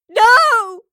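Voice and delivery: loud, high-pitched desperate scream. A narrator's reading. Evie as Astrid is dragged away, screaming. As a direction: scream out in horror to stop it. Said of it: "Evie screamed".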